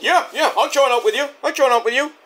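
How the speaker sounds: high-pitched, imitated eagerness; eager agreement. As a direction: agree in a lifted voice. Jericho agrees to join, acted.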